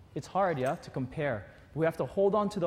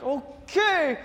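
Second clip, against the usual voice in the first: much higher, strained okay; squeaky okay; 10 semitones higher, 4 dB louder than usual. Retold or imitated. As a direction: agree weakly. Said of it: "I'm like".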